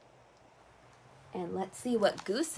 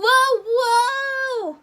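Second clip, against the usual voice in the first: surprised; 12 dB louder than usual. Acted. Slipping and sliding.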